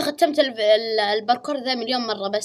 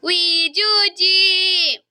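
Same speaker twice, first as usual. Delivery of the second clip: drawn-out squeal; 8 semitones higher, 6 dB louder, much slower than usual. Real, not acted. Squealing at the game character.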